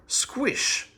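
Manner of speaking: squish sound, unvoiced and high; squish noise. A narrator's reading. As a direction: make the wet squish of sitting in glue. Sitting down in glue.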